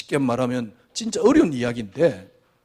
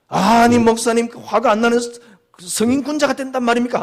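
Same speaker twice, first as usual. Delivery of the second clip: mock objection; 8 semitones higher, 5 dB louder than usual. Imagined objection from congregant.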